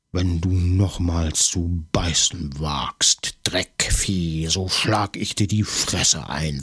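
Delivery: slow hissed threat; threatening whisper. A narrator's reading. Maigret, bitten in the struggle, whispers a threat to the man. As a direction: whisper the threat right in his ear.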